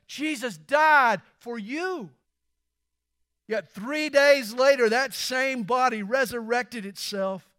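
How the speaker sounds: fervent preacher's cry; preaching at full force. Acted. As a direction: thunder it from the pulpit. Resurrection climax.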